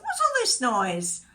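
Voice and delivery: loud demand. Acted. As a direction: call out over a noisy crowd. Jesus confronts the wailing crowd.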